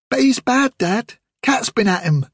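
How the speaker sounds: put-on a girl pleading with her father; pleading child. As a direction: beg dad to let her.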